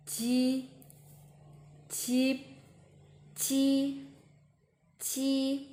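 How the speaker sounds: "ji, jeep, ji, ji" like the start of jeep, softened; level "ji" with a gentle j and long ee. Shown as a demonstration. Teacher modelling from jeep, softened "ji". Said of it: "make it a little bit softer".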